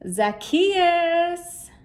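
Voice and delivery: high, loud call up into a tree; friendly ringing call. Acted. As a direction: call him down from the branches. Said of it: "Zacchaeus heard him call".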